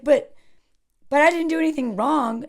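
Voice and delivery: acted-out indignant objection; defensive protest. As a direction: plead innocence, imitating an anxious coworker. Anxious PA protesting, retold.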